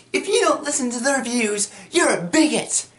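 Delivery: high put-on voice; mock zealous fan. Fans condemn those not listening, mock high scolding. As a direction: imitate them in a squeaky scold.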